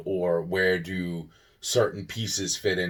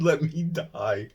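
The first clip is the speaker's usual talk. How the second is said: laughing mock plea; 7 semitones higher, slower than usual. Real, not acted. Repeats after a laugh.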